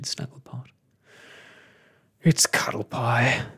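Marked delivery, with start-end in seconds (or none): 2.2-3.5 loud, woeful moan; storybook lament. A narrator's reading. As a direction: moan out the bad news. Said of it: "moaned Mister Lizard".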